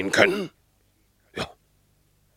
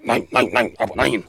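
insistent, louder no, no, no; emphatic denial; 3 semitones higher, 6 dB louder than usual. Acted. Dog rejects the cat's dream of home, insistent denial. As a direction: shake off the idea firmly. Dog firmly contradicts the cat.

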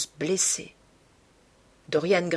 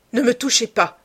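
louder, higher sharp cry; cried out; 4 semitones higher, 6 dB louder than usual. A narrator's reading. Man pushing the girl away, crying out. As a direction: cry out to ward someone off. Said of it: "cria-t-il".

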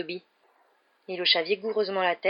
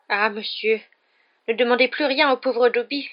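high, louder frightened elf plea; mildly stammering, alarmed house-elf; 5 semitones higher, 6 dB louder than usual. A narrator's reading. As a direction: beg as a panicked elf. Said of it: "balbutia l'elfe".

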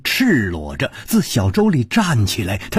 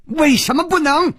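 much higher, loud defiant cry. Acted. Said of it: "大声地说".

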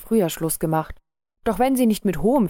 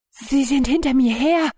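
höher und lauter, gehetzt; 7 semitones higher, 3 dB louder than usual. A narrator's reading. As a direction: atemlos vor Angst hervorstoßen. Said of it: "erklärte er gehetzt". (gehetzt, verängstigt)

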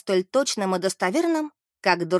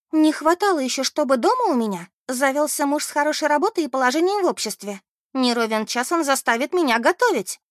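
high, lively indignant character voice; Beth, contentedly single friend; 6 semitones higher, 3 dB louder than usual. Acted. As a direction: scoff at the very idea, pitched up.

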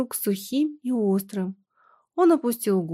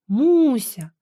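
louder, higher call of concern; alarmed cry; 4 semitones higher, 5 dB louder than usual. A narrator's reading. Husband sees his pale wife, cries out in alarm. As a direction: call out in fright and compassion. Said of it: "С испугом и состраданием вскрикнул он".

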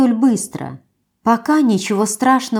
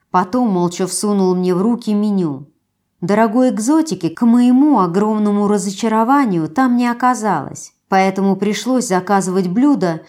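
usual speaking voice

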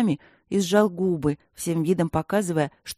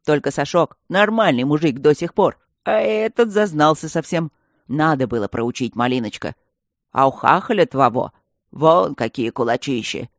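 teasing gargoyle; 6 dB louder than usual. A narrator's reading. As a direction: tease her about the boyfriend.

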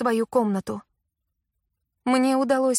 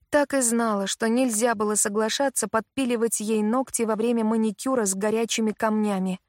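ordinary delivery

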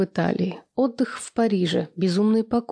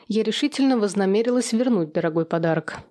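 ordinary delivery, nothing marked

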